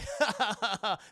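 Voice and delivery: acted chuckle. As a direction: run of mock laughter. Spawn laughs at the answer.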